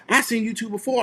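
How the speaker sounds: high, mock Mississippi voice; Mississippi accent. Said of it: "That's my best Mississippi accent".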